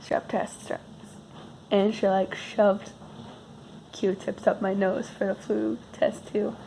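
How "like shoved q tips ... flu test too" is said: usual speaking voice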